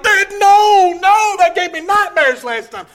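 high, loud mock child's protest; pleading children. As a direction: imitate kids begging Dad in alarm. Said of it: "And the children were like".

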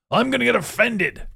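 high put-on voice mocking the easily offended; mock whiny offended person. Mocking listeners who get offended, high whiny put-on voice. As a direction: sneer it like someone hunting for offence.

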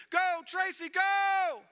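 high, full-voiced cheer. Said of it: "crowd is just getting louder and louder".

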